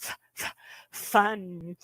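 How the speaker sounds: "fun" with a long airy th start. Shown as a demonstration. Teacher demonstrating breathy substitute in "fun". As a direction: push air behind "fun" without lips. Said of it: "a lot of air behind it".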